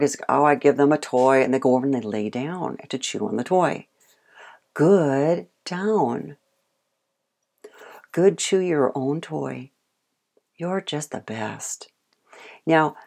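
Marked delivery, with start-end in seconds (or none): 4.7-9.7 calm praise. Shown as a demonstration. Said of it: "they can tell by my voice". 10.6-11.9 soft whisper to the dog; whispered praise. Shown as a demonstration. Trainer whispering praise to a chewing dog. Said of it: "they can tell by my voice".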